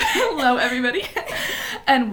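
greeting through laughter; giggling while welcoming listeners; laughing. Real, not acted. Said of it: "that's my newscaster voice".

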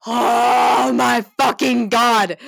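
drawn-out stunned gasp; slow, loud, awed exclamation. Retold or imitated. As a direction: hit it big and slow. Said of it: "And he says".

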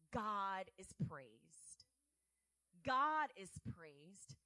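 slow, weighty proclamation of God is praised; emphatic praise. Real, not acted. Preacher declaring to the congregation, slow and emphatic.

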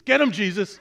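loud, high command to attack; commanding shout. Acted. Parrot orders its Rottweiler to attack, shouting. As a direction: sic the dog on him.

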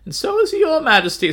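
high, loud, hammed-up aristocrat; [name]'s aristocratic voice. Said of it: "That's my aristocratic [name] voice".

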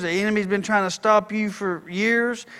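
ordinary talk

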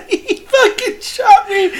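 high, squealing laugh through the words; helpless, drawn-out high laughter while talking; laughing hard. Real, not acted. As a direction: barely get it out for laughing. Laughing at absurd tall tale.

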